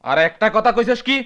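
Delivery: stern warning. Acted. Warning brother mid quarrel.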